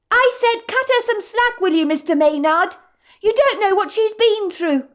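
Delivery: high, loud defiant repeat; a waitress repeating her retort to the manager; young waitress voice. Acted. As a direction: throw the words back at him, high and firm. Madison repeats her words.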